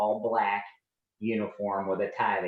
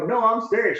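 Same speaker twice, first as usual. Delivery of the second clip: loud and high, pleading insistence; insistent pushback; 12 semitones higher, 7 dB louder than usual. Retold or imitated. Son arguing with his mom about the Navy, loud insistent reply. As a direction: push back hard, mean it.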